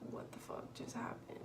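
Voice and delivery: hushed imitated onlooker; whispered. People in the waiting room staring, whispered. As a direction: whisper it like shocked strangers.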